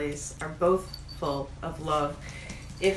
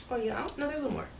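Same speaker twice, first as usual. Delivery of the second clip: usual speaking voice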